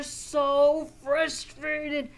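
loud, slow mock frustration; acted frustration. Teacher describing feeling stuck to children, hammed-up frustration. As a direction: lean hard on the feeling, hammed up.

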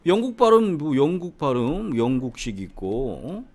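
mimicked learner's objection; imitating know-it-all students. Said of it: "여러분들이 생각하는".

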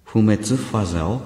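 不滅ファゼオ with a playful French flavour, laughing; fake French pronunciation. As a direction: pronounce 不滅ファゼオ like mock French. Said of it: "フランス語っぽく言うと？".

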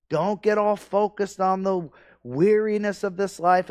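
full-force preaching. Acted. Pastor exhorting the congregation not to lose focus, full preaching. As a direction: pound it home like a revival preacher.

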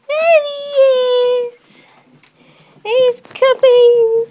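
thrilled he's finally coming; excited call. Real, not acted. Owner calling out to the family as the dog arrives, excited shout.

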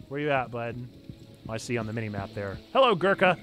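normal talking voice

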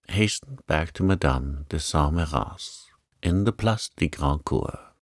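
plain reading voice

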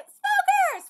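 big loud call, pitched high; shouting a name. Acted. Calling Smoker from far away, shouting. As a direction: yell it across the distance.

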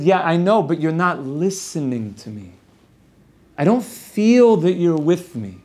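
a wife's wounded appeal, lightly acted; hurt complaint. Wife telling husband he isn't with her, gently hurt.

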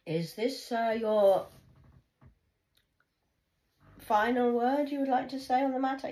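mother taunting daughter again; mock formal question. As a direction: ask it with mock politeness. Mocking final question.

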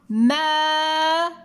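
ماء as long held maa, pause, then un; slow teacher's breakdown of ماء into maa and un; split syllables. Shown as a demonstration. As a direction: hold the maa of ماء steadily, then a separate un. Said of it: "لاحظوا أين سمعتم صوت الهمزة".

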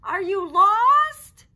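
drawn-out dragon bellow. Acted. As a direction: shout the question across the night. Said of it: "bellowed Dragon".

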